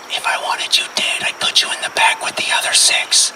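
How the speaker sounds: menacing stage whisper; hushed, deliberate menace; whispered threat. Retold or imitated.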